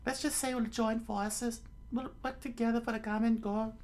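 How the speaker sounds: high falsetto, agreeable; high young woman, with a smile; Nami. Acted. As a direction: propose a truce in a put-on female voice. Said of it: "she said with a smile".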